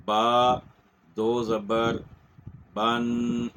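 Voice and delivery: با, دو زبر, بن spelled out in slow separate beats, ending on a clear nasal n; spelling out tanween. Shown as a demonstration. A Quran teacher spells it out for students, each part apart. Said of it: "تو ہم کہیں گے".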